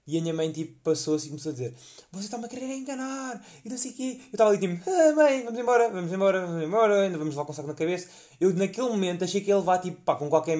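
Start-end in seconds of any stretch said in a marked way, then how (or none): 2.1-4.2 high, soft imitation of an indignant mother; high mock-indignant mom voice; mother's complaint. 4.9-8.1 higher, louder, panicky begging to leave; nervous high-pitched pleading; urgent pleading. Retold or imitated. Urging mother to leave.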